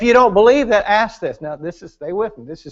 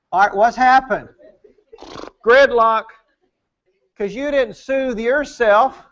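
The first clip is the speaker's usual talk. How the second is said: normal talking voice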